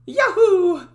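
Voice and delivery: high, loud Mario-style yahoo; cheery Yahoo. Acted. Dev testing a character voice, loud Mario-like whoop. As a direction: shout it like a jumping plumber. Voice warmup yell.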